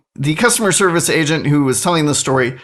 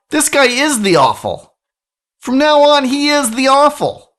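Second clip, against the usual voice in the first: mock proclamation; 8 semitones higher than usual. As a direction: proclaim the new name grandly. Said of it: "we're just gonna call him the awful".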